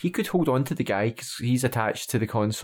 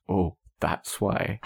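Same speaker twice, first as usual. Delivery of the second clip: slow, drawn-out sarcastic remark; mock the answer, lower and slow; 5 semitones lower, much slower than usual. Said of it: "a sarcastic".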